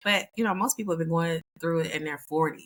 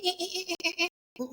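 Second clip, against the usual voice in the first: engine sputter; 12 semitones higher, 5 dB quieter, much faster than usual. Retold or imitated. Imitating an engine starting up.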